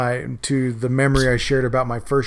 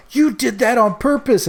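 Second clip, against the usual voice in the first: angry accusation; 11 semitones higher than usual. Retold or imitated. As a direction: hurl the accusation. Angry kid accusing cousin.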